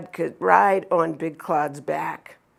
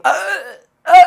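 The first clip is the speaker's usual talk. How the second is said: mock choking sound; 9 semitones higher, 11 dB louder than usual. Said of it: "As he's choking".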